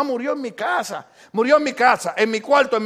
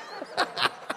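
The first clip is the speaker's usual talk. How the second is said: breathy laugh; 8 dB quieter than usual. Real, not acted. Laughs at himself.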